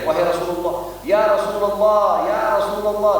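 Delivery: calling out from afar, voice rising; loud calling out. Retold or imitated. Abu Bakar calls out to the Prophet from afar, loudly. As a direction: call the Prophet loudly from a distance. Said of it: "dengan suara keras".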